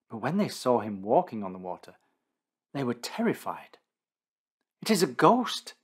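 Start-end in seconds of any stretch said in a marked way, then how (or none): 4.8-5.7 high, loud cry of fright; frightened exclaim. Acted. Terrified disciples cry out at a figure on water, high frightened exclaim. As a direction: cry it out as if seeing a ghost. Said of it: "they exclaimed".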